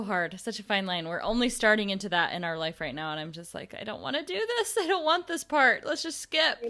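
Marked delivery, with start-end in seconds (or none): 3.8-6.6 mock whine. Friend voicing her own dread.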